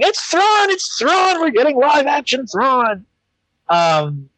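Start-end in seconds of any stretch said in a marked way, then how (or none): 0.0-3.0 mock screaming. Frustrated host imitates everybody reacting to casting news, mock screaming. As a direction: squeal it high, imitating overexcited fans. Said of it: "everybody just starts screaming".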